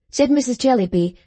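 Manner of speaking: plain reading voice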